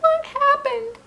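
put-on high small girl's voice; sniffly little girl asking; high child voice, hopeful; tearful girl. A narrator's reading. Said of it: "wiping her eyes".

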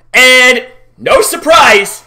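ordinary talk, nothing marked

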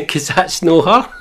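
high, loud, slow punchline through laughter; punchline cracking up. Retold or imitated. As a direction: barely get the punchline out for laughing. Punchline of retold crying joke.

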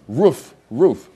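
loud, hammed-up dog bark. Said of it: "So the dog goes".

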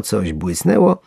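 usual speaking voice

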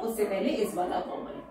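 usual speaking voice

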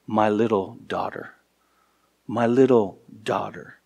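soft, tender repeat of "My little daughter"; tender affection. Acted. Pastor voicing Jairus pleading for his dying daughter, soft and tender. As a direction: say "My little daughter" softly like a loving father. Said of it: "hear that tender affection in his voice".

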